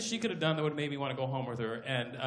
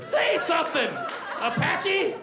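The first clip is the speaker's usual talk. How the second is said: urging on; 10 semitones higher, 4 dB louder, slower than usual. Acted. Urging Apache Chief.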